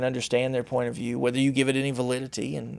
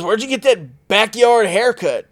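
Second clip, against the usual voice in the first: higher, slower mocking comeback; imitating Malik's retort; 9 semitones higher, 6 dB louder than usual. Malik insulting narrator's haircut, higher slow taunting voice. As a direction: fire back a taunt, drawn out and pitched up. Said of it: "and he goes".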